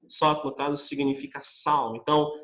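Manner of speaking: ordinary talk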